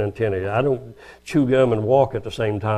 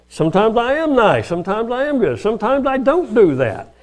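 high, louder mock indignant insisting; wounded husband defending himself, mock indignant; defensive protest; 11 semitones higher, 4 dB louder than usual. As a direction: plead your own case, half joking.

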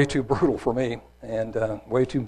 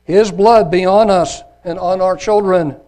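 loud, slow, raised declaration; people's answer; 6 semitones higher, 9 dB louder, slower than usual. A narrator's reading. All the people answer Pilate, proclaiming loudly. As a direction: proclaim it heavily as a whole crowd. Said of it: "Then answered all the people".

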